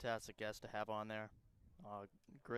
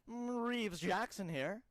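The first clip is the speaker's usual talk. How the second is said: higher, drawn-out mock announcer voice; Reeves Jackson; 8 semitones higher, 3 dB louder, slower than usual. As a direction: slowly put on a colleague's broadcast call. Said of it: "I'll introduce myself as Reeves Jackson".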